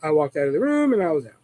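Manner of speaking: usual speaking voice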